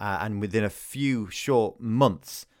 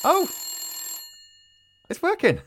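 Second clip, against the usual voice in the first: excited shout. Real, not acted. Pink phone suddenly rings.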